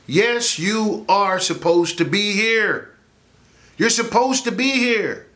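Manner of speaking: high, slow insistence; fervent reassurance to the audience; emphatic rebuttal. Real, not acted. Preacherly rebuttal to the phrase.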